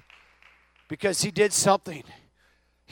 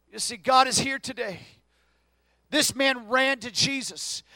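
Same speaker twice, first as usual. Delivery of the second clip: ordinary talk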